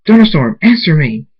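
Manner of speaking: loud, high demand for an answer; urgent call. A narrator's reading. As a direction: call out sharply to a companion. Character calling urgently to companion.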